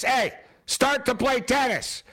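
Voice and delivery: high, slow demand to absent players; mock impatient call. Host waiting for tennis coverage, mock impatient calling out.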